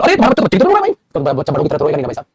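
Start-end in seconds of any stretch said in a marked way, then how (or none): 0.0-0.9 mock remark. Teacher acts a remark to parent.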